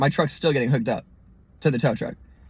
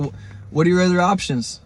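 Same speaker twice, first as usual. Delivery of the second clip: higher-pitched, pointed question; imitated challenge; 5 semitones higher than usual. Tow crew to the stranded driver, imitated pointed question. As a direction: imitate their pushy query. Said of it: "they said it like that".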